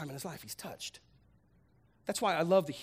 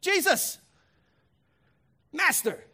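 loud, high cry across a distance; a leper begging for help from afar; desperate yell; 11 semitones higher, 7 dB louder, slower than usual. Retold or imitated. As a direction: call out to Jesus from far back. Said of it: "he's yelling".

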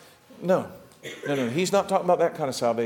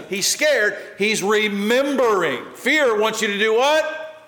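ordinary delivery

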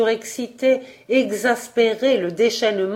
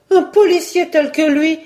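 put-on young woman objecting; 9 semitones higher, 6 dB louder than usual. A narrator's reading. Simone objecting to Jacques.

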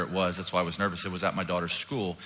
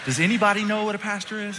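high, raised-voice appeal; exasperated repeat; 11 semitones higher than usual. Retold or imitated. Pastor appealing to the rest of the class, exasperated. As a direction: call it out high over the eager kid. Repeats question over the eager kid.

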